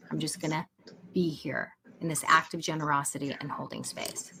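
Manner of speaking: calm, steady holding space; gentle sincere reassurance; reassuring message. Retold or imitated. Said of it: "We're sending the message".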